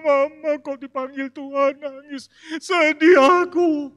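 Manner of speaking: slow, high, whiny voice of a weeping mourner; a hammed-up mourner at the wake; grieving mourner. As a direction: plead it like a sobbing child at a funeral.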